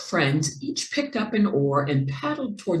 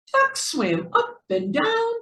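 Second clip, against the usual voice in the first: usual speaking voice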